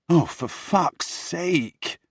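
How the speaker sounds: high, drawn-out exasperation; exasperated. Acted. Henry reacting to his shouting brother, exasperated. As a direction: groan it out in exasperation.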